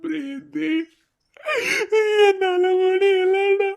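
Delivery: broken, tearful lament; crying.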